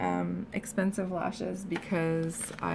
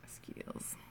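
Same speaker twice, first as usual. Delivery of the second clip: whispered; 18 dB quieter than usual. Real, not acted. Muttered self-praise.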